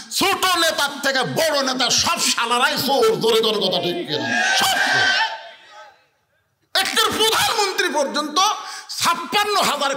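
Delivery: high, forceful denunciation to a crowd; rabble-rousing preaching. Acted. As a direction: rouse the crowd against thieves. Preacher rouses crowd against leaders.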